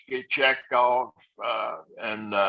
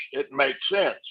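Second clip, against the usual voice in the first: ordinary delivery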